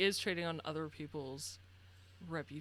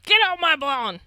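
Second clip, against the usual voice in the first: much higher, much louder grumpy bark; angry yell; 8 semitones higher, 14 dB louder than usual. Acted. Deaf old man yelling at visitors on his porch, angry. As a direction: shout the kids off the lawn.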